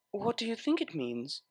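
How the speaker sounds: soft Octavia voice. Acted. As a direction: ask it softly, teasing. Octavia voiced, teasing softly.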